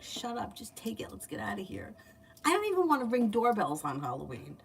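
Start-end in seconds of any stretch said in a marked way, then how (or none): 0.0-1.9 softly muttered, pretend telling-off; embarrassed hushing parent. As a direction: hush your kid under your breath. Imagined embarrassed parent hushing kid.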